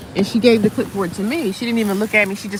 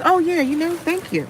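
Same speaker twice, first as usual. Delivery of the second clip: mock sugary thanks; 9 semitones higher than usual. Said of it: "And I was like".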